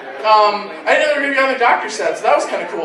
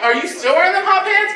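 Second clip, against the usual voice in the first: 1 camp party guy, pitched higher; 8 semitones higher than usual. Retold or imitated. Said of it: "I hear one look at the other one. He goes".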